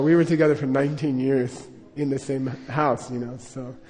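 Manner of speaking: usual speaking voice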